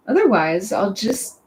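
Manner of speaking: ordinary talk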